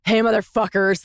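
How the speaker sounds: miserable performer's greeting; hammed-up miserable hello; mock misery. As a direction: greet the crowd while dropping the happy act. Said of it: "like, I'm miserable".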